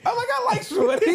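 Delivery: loud, high, laughing hard; laughing out the words; cracking up. Real, not acted. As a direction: say it through a burst of laughter. Cracking up at the retold remark.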